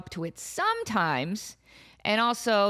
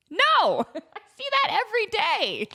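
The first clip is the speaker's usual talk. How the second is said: emphatic no; 10 semitones higher than usual. Real, not acted. Rejecting the imagined exhibit.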